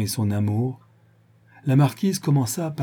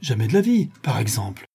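alarmed cry. A narrator's reading. Man aghast at her bedroom offer, mildly alarmed. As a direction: recoil in shock, without really shouting. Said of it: "Il bondit et cria, effaré".

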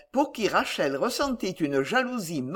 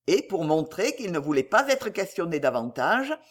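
usual speaking voice

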